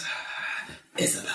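put-on old lady whispering a name. Old lady whispers Isabel's name as she passes, hushed. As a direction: breathe the name out, unvoiced and low. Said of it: "And then she goes".

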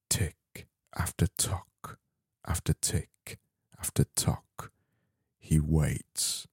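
dramatic whisper. Pretend. Parodying the Guinness surfer advert, slow dramatic whisper. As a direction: breathe it like a moody beer advert.

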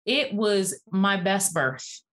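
ordinary talk, nothing marked

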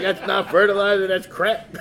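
high, loud comic scolding; God. Retold or imitated. God rebuking the dog owner, comic scolding. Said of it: "God, God was like".

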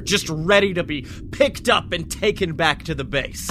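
ordinary talk

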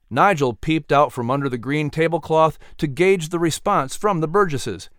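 usual speaking voice

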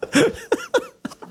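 a high, helpless squeal of laughter. Real, not acted. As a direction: let the laugh fly up into a squeak. Cracks up after joke.

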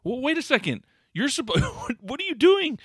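high-pitched, baffled protest; incredulous reaction. Retold or imitated. Viewer questioning an out-of-place scene, incredulous. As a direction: voice jumping up in mock disbelief.

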